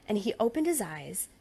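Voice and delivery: ordinary delivery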